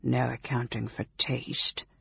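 low, soft aside of disdain; quietly sneering; lip-curling sneer. A narrator's reading. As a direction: mutter it with a curled lip. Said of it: "his lip curling".

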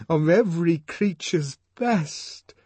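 high, loud, slow climax of verse; impassioned lover reciting; adoring recital. A narrator's reading. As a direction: declaim it rapturously, stretched out.

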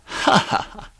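high, breathy staccato laugh; evil, voiceless ha-ha; dry, acted villain laugh; villainous chuckle. Villain laughs between phrases.

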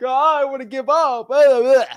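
high, loud whining mockery of a quitter; mocking a wholesaler who wants to quit, high whiny voice; mock whiny crybaby. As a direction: whine it like a sulking kid, hammed-up. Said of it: "you start crying like".

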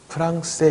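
프랑세 as Korean "peu-rang-se"; wrong Korean-style pronunciation. Shown as a demonstration. Teacher showing the mistake, 프랑세 mispronounced Korean-style. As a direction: say 프랑세 in Korean syllables with "ang". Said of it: "이런 식으로 하시면 안 되는".

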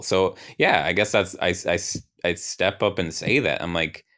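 usual speaking voice

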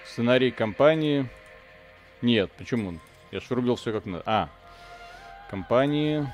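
usual speaking voice

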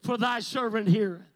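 loud, solemn King James answer; answering the Lord. A narrator's reading. Samuel finally answers God, solemn and loud. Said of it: "Tell him".

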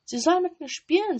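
put-on high, loud spoiled child; demanding robber girl. A narrator's reading. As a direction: demand it like a bossy little girl. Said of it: "sagte das kleine Räubermädchen". (hohe, laute, fordernde Kinderstimme)

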